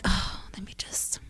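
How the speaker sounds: breathy whispered aside to herself. Retold or imitated. Whispering to herself while a tear falls. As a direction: breathe it under her breath.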